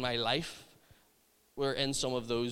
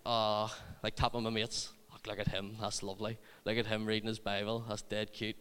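softer, lower cooing to mates; fond aside; 5 semitones lower, 5 dB quieter than usual. Retold or imitated. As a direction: quietly admire him, as if nudging friends. Fondly nudging mates about him.

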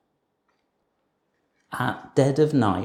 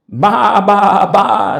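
loud high bleats; hen baaing; 9 semitones higher, 12 dB louder, slower than usual. A narrator's reading. Hen fooling the thieves, loud high baa. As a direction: bleat like a sheep. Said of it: "said the fat red hen".

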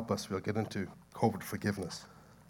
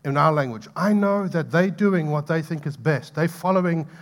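raised, pleading voice crying out to God; loud pleading cry; 4 semitones higher, 9 dB louder than usual. Retold or imitated. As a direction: cry it out to heaven, begging. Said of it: "he cried out into eternity".

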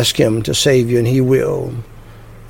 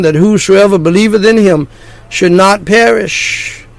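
preaching; 3 semitones higher than usual. Acted. Preaching hell and salvation.

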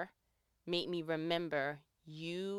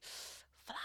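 whispered; 17 dB quieter than usual. Retold or imitated. Inner urging quoted to herself.

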